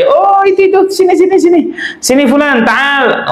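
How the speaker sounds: high, carrying call across the room. Retold or imitated. Teacher calls student Fulan over, calling out high. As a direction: call him over from a distance.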